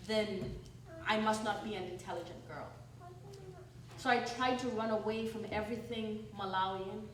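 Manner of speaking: normal talking voice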